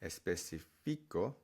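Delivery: "específico" wrongly stressed on FI, especi-FI-co. Shown as a demonstration. Teacher showing learners what to avoid, misplaced stress on FI. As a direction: push the stress late onto FI in "específico". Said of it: "So instead of saying".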